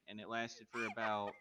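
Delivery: usual speaking voice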